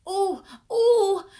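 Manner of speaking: high, loud straining call for attention; bursting to be called on; eager oh oh. A narrator's reading. Mac straining for the teacher's attention, eager high call. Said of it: "Mac groaned".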